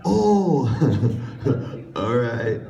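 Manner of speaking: mock stoned giggling, high and loud; imitated laughing. High kid told to try again, imitated giggly laughing. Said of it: "And he's laughing".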